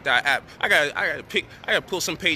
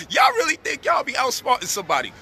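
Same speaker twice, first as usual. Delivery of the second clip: shouting; 8 semitones higher than usual. Real, not acted. Mocking his critics.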